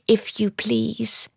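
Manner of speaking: slow, fawning and high; sycophantic. A narrator's reading. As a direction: grovel over the words. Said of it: "in a sickeningly sycophantic tone".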